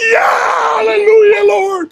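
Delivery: high shouted hallelujah cheer, voice cracking. Retold or imitated. Cheering as he retells the perfect crane shot, high and shouting. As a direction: shout it up to the Lord in triumph. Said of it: "When you see me out there cheering".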